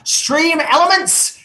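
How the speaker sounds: high, drawn-out announcement of a name; grand shout-out reading. Real, not acted. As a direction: read it out slowly like a star's name. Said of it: "love how you read Stream Elements".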